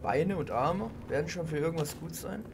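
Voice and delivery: usual speaking voice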